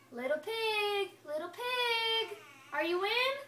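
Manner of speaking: high, slow, drawn-out holler to the pig. Acted. Wolf hollers at the stick house door, drawn out. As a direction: call long and high through the door. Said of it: "So I collared".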